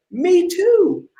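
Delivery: a high, eager "Me too"; eager fake agreement. Salesman pretending to share the client's faith, in eager high agreement. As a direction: jump at the chance to fit in. Chameleon seller lies eagerly.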